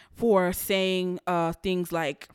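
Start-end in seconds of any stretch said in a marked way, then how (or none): none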